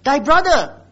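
loud, pointed "thy brother"; stress on brother. Shown as a demonstration. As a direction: punch "thy brother" after a short pause. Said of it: "purposely use the word thy brother".

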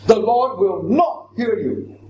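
slow, forceful stress on NOT; "not" stressed firmly in a slow declaration; emphatic. Shown as a demonstration. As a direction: drive home "not", word by word.

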